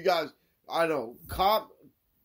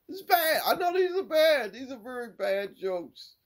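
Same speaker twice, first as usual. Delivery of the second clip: squealed, loud admission easing down; high-pitched protest; 12 semitones higher, 5 dB louder than usual. Real, not acted. Host owning up to listeners about bad jokes, high-pitched protest. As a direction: start very high and loud, then come down.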